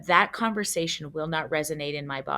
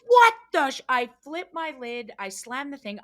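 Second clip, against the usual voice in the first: ordinary delivery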